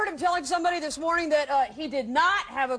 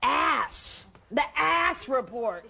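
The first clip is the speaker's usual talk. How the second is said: taunting Sally; much slower than usual. Acted. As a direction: taunt the men with it, dragged out.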